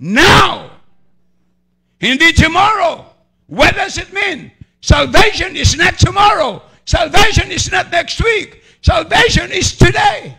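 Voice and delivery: preacher shouting to the congregation, slow and emphatic; fervent preaching shout. Real, not acted. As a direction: hammer home that salvation is now. Preacher's climactic declaration.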